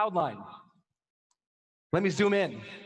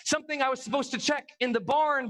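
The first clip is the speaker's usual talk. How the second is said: usual speaking voice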